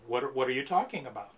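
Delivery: ordinary delivery, nothing marked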